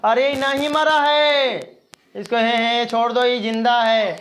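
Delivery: high, loud, slow lament; mock wailing of a frantic wife. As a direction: cry out that he is alive. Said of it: "अब उसकी पत्नी रो रही है".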